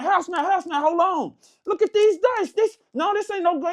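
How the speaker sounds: high-pitched, urgent calling over. Retold or imitated. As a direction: flag down the house man, worked up. Calling the house man over urgently.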